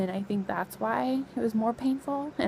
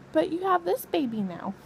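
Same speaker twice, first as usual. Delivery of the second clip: higher-pitched child imitation; little son's voice; 8 semitones higher than usual. Son touching mom's pregnant belly to console her, imitated high child voice. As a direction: lift it high like a small boy.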